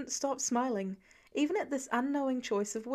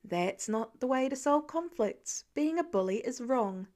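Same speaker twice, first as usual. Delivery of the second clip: ordinary delivery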